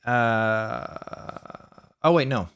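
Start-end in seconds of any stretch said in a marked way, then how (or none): none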